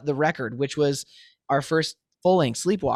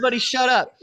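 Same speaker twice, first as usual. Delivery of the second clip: much higher, louder snap of frustration; exasperated outburst; 9 semitones higher, 3 dB louder, faster than usual. Retold or imitated. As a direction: blurt it out, fed up with everyone. Frustrated artist mocking all the voices.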